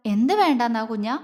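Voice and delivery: ordinary delivery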